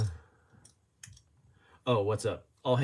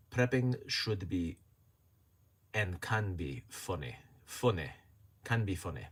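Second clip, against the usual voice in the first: imitated Scottish accent; 5 semitones lower, 4 dB quieter than usual. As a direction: read it out in a practised Scottish accent. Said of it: "practicing my Scottish accent".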